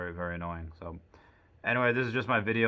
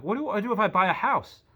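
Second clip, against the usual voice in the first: incredulous squeak; 12 semitones higher, 5 dB louder than usual. Retold or imitated. Arguing with bank clerk.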